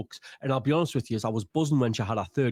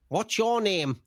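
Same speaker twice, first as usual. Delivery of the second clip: stupid voice; 7 semitones higher, slower than usual. Retold or imitated. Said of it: "doing this stupid voice to each other".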